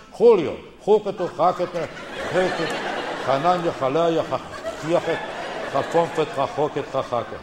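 throaty nonsense riff with rasped Spanish j sounds; mock Spanish names. Comic riffing on Spanish kids' names, mock Spanish babble.

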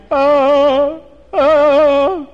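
high, loud crying wail; starting to cry. Acted. Beggar bursts into tears at the wedding, high wailing cry. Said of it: "the beggar starts to cry".

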